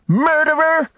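shrieked accusation at full pitch; shouting. A narrator's reading. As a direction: hurl the word at her. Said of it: "who shouted".

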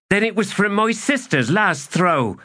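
very high, indignant comeback; retort. Acted. Kisware blames the sister for gummed bones, retorting. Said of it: "Kisware retorted".

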